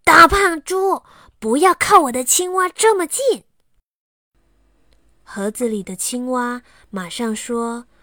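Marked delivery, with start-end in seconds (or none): 0.0-3.4 loud, higher, rude yell; angry shout. Acted. Xiaowei yells at Aliang to keep off his frog, loud and rude. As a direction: shout him away from the frog.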